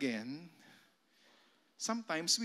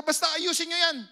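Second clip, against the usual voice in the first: loud, high curt command; bossy order; 11 semitones higher, 8 dB louder, faster than usual. Retold or imitated. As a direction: order them around like the boss. Boss ordering workers. (malakas na utos ng amo)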